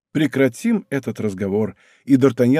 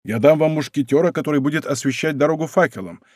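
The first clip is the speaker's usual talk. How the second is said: usual speaking voice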